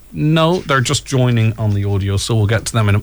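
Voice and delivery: ordinary talk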